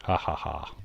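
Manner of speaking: ordinary talk, nothing marked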